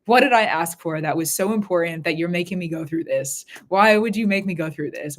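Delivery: ordinary talk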